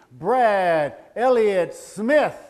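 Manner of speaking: slow, drawn-out name call; announcer calling the son's name across the stage; graduation announcer. Retold or imitated. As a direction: announce each name grandly. Graduation name being called.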